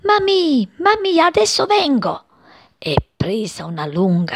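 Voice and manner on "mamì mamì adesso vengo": very high, excited cry; chick shouting. A narrator's reading. Chick runs shouting to his mother, very high. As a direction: shout it up high as you run. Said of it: "Correva e gridava".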